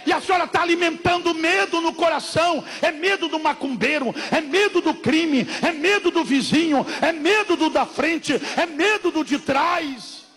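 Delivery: loud, high shouting sermon; fiery preacher's shout; preaching at full force. Acted. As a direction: thunder it at the congregation.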